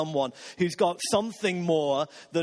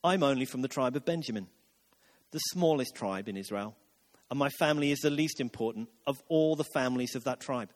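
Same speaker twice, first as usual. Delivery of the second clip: low, softened, self-effacing reply; meek, low-pitched humble protest; self-deprecating Saul; 7 semitones lower, 3 dB quieter than usual. Acted. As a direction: play down your own importance, voice dropped. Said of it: "But Saul replied".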